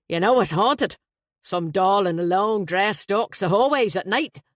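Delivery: a flustered, jovial Dubliner blurting out; Irish best man. Acted.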